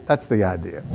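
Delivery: ordinary talk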